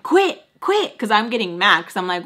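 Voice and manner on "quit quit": high, loud sharp command. Retold or imitated. Daughter telling her mom to stop, high and loud. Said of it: "And I'm like".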